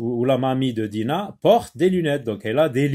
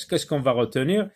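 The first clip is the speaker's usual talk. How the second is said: ordinary talk, nothing marked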